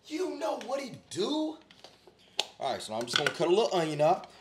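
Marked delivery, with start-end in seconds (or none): 0.1-1.6 slow high catchphrase. Acted. Host opens his cooking show with a slow, high catchphrase.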